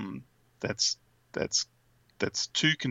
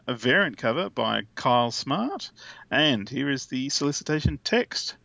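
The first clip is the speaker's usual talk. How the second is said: ordinary talk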